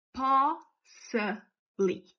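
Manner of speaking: "possibly" drawn out slowly, weight on the first O; stress on the O. Shown as a demonstration. Teacher slowly stressing the syllable for the class. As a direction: lean hard on the POS of "possibly". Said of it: "com stress, a parte forte no O".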